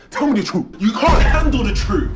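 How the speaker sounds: high, full-volume demand building to a yell; angry courtroom shout. Acted. Lawyer confronting witness over lies, furious escalating shout. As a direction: explode at the witness.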